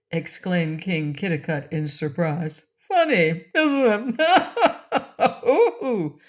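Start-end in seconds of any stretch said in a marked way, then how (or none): 2.9-5.4 laughing. Acted. First catchphrase take. 5.5-5.5 laughing. Acted. Rinky Tink laughing at himself. 5.5-6.2 high giggling ooh; jolly laugh. A narrator's reading. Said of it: "laughed King Rinky Tink".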